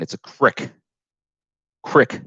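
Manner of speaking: crick in a mock northern accent. Shown as a demonstration. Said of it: "Excuse my northern accent".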